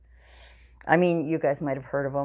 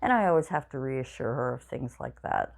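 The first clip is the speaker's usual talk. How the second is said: usual speaking voice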